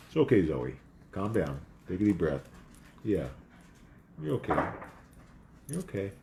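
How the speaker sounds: soft, low comforting murmur; calm the frightened dog down gently; soothing the dog. Real, not acted. Soothing the crying dog Zoe.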